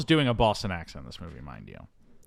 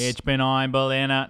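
put-on raised, parody Boston accent; JFK; 3 semitones higher, 4 dB louder than usual. Host riffing on the president, hammed-up Boston accent. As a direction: orate it in a mock presidential Boston voice. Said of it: "He doesn't go full J F K".

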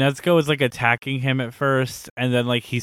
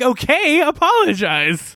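high, louder mock demand, slow on apologize; smug demand; 13 semitones higher, 4 dB louder than usual. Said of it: "the guy's like".

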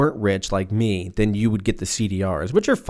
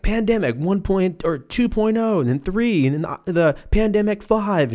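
mock product names; 9 semitones higher than usual. Listing pandemic versions like iPhones.

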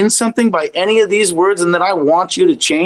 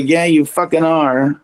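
ordinary talk

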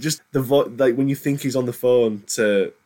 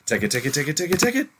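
mock game characters urging; 6 semitones higher, faster than usual. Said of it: "being like".

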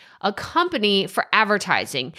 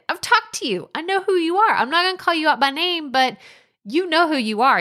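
teasing; 8 semitones higher, faster than usual. Real, not acted. Teasing listeners who know themselves.

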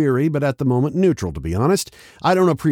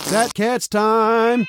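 announcer; 8 semitones higher, much slower than usual. Acted. Segment jingle line.

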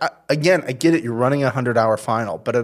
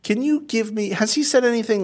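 mock producers; 11 semitones higher than usual. Producers asking for dirt.

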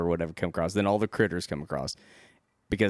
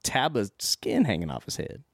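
higher-pitched retold "what the hell"; mock bafflement; 7 semitones higher than usual. Fan retells her reaction to hanging skin, mock bafflement. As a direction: imitate her puzzled outburst, pitched up. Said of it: "Then she's like".

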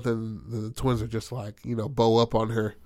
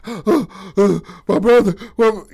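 stricken cry; 10 semitones higher, 10 dB louder, slower than usual. Retold or imitated. Rand sees brother shot.

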